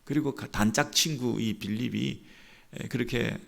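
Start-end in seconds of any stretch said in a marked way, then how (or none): none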